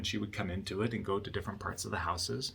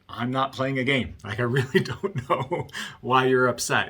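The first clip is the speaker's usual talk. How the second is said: louder, mildly exasperated mock protest; retold protest; 3 semitones higher, 7 dB louder than usual. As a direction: push back as the accused wife. Wife protests in retold quarrel.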